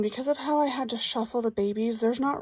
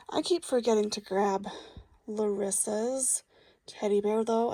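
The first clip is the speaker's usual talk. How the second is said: ordinary talk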